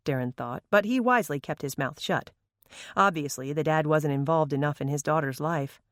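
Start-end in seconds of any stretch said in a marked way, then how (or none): none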